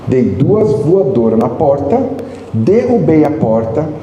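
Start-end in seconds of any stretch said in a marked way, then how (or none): none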